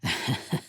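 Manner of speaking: breathy, quiet chuckle; a little unvoiced laugh; soft laugh. Amused after rallying the party, soft laugh.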